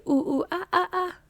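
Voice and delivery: high mock monkey hoots; high imitated monkey chatter; toy monkey noise. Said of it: "And it's like".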